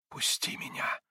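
faint whispered plea. A narrator's reading. Katya begs Mitya to let go, whispering. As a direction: whisper it weakly. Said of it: "прошептала она".

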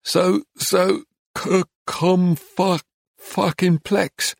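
slow, high, halting stutter; a stuttering young man fumbling a long word. A narrator's reading. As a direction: stumble through each syllable, stuck on the sounds. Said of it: "trying to explain in a stutter".

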